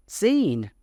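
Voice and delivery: "seen" said to rhyme with Bean; rhymed mispronunciation. Shown as a demonstration. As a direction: say "seen" as Sean rhymed with Bean, set apart. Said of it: "then Sean should be pronounced".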